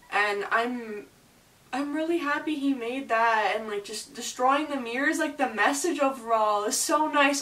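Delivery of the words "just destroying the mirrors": usual speaking voice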